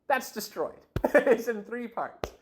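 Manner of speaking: laughing amazed at the break; laughing disbelief. Real, not acted. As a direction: chuckle through it, amazed. Laughing at the x-ray.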